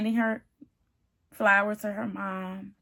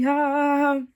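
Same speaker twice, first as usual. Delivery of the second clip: higher-pitched mock laugh; goofy laugh; 3 semitones higher than usual. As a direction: imitate an oblivious groom's goofy ha-ha. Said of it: "sitting there goofy".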